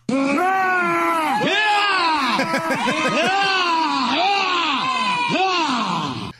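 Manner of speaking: high yelling to shoo. Real, not acted. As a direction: yell and whoop at a bear. Shooing bear clip.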